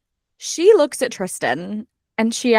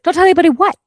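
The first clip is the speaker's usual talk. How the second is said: high loud demand; exasperated shout; 9 semitones higher, 8 dB louder, much faster than usual. Retold or imitated. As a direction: yell it at the page. Yelling at the book.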